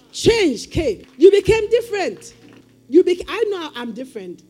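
loud and high, building; preaching climax. Real, not acted. Preacher proclaiming change to the congregation, shouting. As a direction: lift it into a shout.

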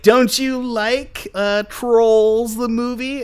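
high, slow, drawn-out coaxing question; mock sponsor pitch. Imagined studio sponsor coaxing the host, mock pitch. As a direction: pretend to be a leading, pushy sponsor.

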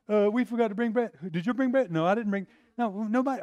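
mock the disciples panicking about bread; mock disciples fretting to each other over forgotten bread; flustered disciples.

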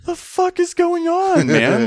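very high, loud, slowed mock panic; hammed-up squeal of alarm; panicked recruit. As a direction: choke it out while being strangled. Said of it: "I'm like".

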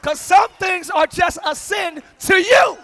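loud, high, slow shouted preaching; slow shouting sermon peak; full-force preaching. Acted. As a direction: hammer the warning home to the congregation.

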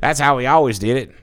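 put-on Southern accent. Defending how the family always did it, Southern accent. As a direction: play a stubborn Southern traditionalist. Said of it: "to do a Southern accent".

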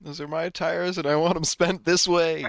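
high, loud joking complaint; playfully voicing the friend; mock taxpayer. Mimicking friend's cop-taunting line.

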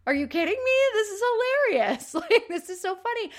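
high, laughing imitation; cracking up. Sister laughs at the mud-covered club girls, high mock cracking up. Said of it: "Marcia just starts cracking up".

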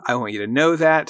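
ordinary talk

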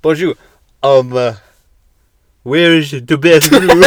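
high, loud, slow hammed-up French accent; mock French accent. Pretending to be a French tourist in a hammed-up French accent. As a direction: ham up a French tourist asking for the bathroom. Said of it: "Just talk in the accent".

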